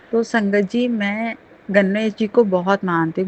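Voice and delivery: ordinary talk, nothing marked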